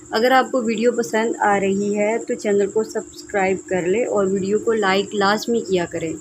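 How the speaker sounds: usual speaking voice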